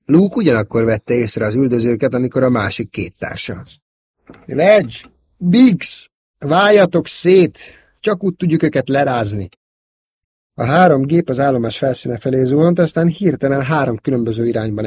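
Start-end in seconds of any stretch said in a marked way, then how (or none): 4.5-9.5 high, loud orders; calling out. A narrator's reading. Luke orders Wedge and Biggs to split up, loud. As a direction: shout to the wingmen to scatter.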